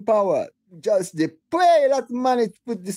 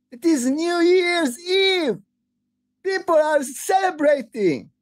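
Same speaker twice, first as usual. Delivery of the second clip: real furious shout, pitched higher and louder; outraged rant at the president, shouting; angry shouting; 7 semitones higher, 4 dB louder than usual. Real, not acted. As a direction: yell it out in raw anger, near tears. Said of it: "I'm sorry I'm shouting".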